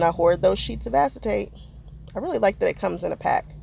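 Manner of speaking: ordinary talk, nothing marked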